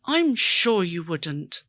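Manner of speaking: ordinary talk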